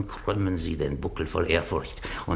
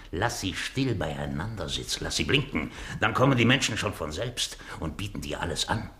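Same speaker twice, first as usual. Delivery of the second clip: usual speaking voice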